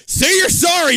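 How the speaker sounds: high loud shout; shouted demand. Acted. Big brother shouting at Denny to apologize, high and loud. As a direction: bark the demand. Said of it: "you demand".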